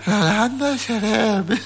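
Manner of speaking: very slow, drawn-out syllables; slow motion. Shown as a demonstration. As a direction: stretch every sound out slowly. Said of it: "En cámara lenta".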